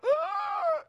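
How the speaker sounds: a high, startled cry of dismay; father-in-law shrieks at the tattoo; shocked dad's yelp. Retold or imitated. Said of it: "her dad would go".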